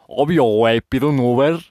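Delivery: loud, breezy joking boast; mock bravado. Host joking about easily reaching Mictlán, loud mock bravado. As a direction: announce the silly plan loudly. Jokey acted easy trip to Mictlán.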